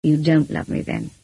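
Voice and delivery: subdued, hurt conclusion; understated sorrow; sadly. A narrator's reading. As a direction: let it sink, sad and resigned. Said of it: "he said sadly".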